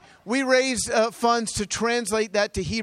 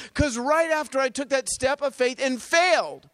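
normal talking voice

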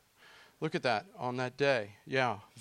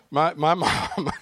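slow, louder, breaking into a laugh; a real laugh breaking in; 2 semitones higher, 7 dB louder, slower than usual. Real, not acted. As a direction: laugh through the words. Laughing at the memory.

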